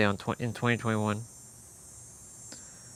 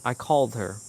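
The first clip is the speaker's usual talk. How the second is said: ordinary talk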